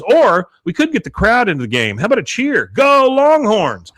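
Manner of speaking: Peggy trying to rouse the crowd, rising very high and loud; cheering. Retold or imitated. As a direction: belt out the cheer, slowing at the end.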